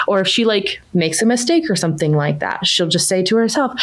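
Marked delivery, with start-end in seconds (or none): none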